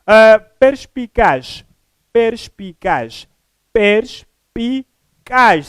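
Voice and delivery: "perspicaz" slowly broken syllable by syllable, per-pi-caz; split into syllables. Shown as a demonstration. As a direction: spell out "perspicaz" syllable by syllable with a clear pause between each. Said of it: "São três sílabas".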